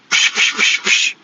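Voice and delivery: unvoiced psh noises for popping hoses; hose pops. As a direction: spit out the hiss of bursting hoses. Imitating hydraulic hoses popping.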